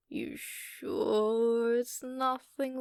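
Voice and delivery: ordinary delivery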